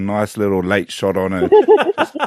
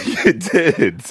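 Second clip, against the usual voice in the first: laughing tease; 9 semitones higher, slower than usual. Real, not acted. Teasing while laughing.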